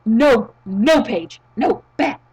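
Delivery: loud, firm telling-off in short bursts; mock scolding. Podcast host telling Paige off over team name, mock scolding. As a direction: scold as if to a naughty dog.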